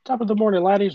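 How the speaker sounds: high, loud mock Irish greeting; Irish accent. Friend doing a loud stage Irish accent for a movie cameo. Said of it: "but, like".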